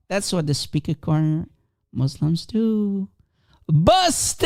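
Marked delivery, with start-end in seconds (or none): 3.7-4.5 triumphant shout. Real, not acted. Gotcha exclamation.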